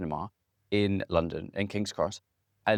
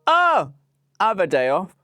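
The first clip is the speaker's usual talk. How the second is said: excited outburst; 9 semitones higher, 8 dB louder than usual. Real, not acted. Excited about Incredibles two.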